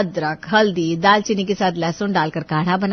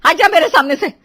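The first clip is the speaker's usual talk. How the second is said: high, loud angry yelling; furious order; 8 semitones higher, 8 dB louder than usual. Acted. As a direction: send her off in a rage. Furious mother orders her away. (ग़ुस्से में चिल्लाकर हुक्म)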